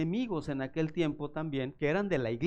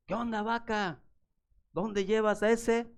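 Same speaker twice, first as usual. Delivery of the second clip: high, teasing bully voice; mocking taunt; 7 semitones higher, 3 dB louder than usual. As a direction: needle the boy through the cow, slowly. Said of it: "siempre le decía a la vaca". (burla del chavo mayor)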